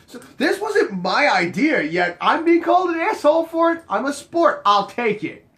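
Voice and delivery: high, loud self-defence; hammed-up aggrieved reply; mock indignant protest. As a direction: play up the wounded fairness. His retold protest at being blamed.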